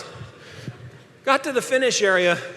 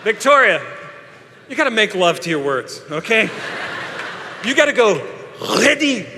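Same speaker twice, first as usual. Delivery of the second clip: mock French starter. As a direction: imitate the coach telling her to savour the words. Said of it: "and she says".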